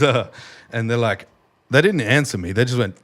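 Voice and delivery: ordinary talk, nothing marked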